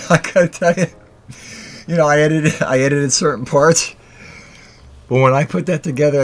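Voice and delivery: ordinary talk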